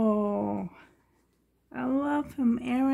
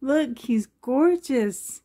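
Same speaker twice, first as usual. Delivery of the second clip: ordinary talk, nothing marked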